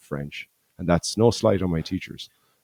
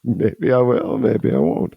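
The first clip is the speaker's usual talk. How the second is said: playful tease; 5 semitones higher, slower than usual. Real, not acted. Teasing the gig address.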